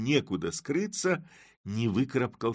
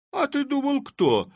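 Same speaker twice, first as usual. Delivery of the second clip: high, loud teasing voice; mocking Chub; 8 dB louder than usual. A narrator's reading. Chub teasing his friends from the sack, high and loud. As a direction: crow it out with glee.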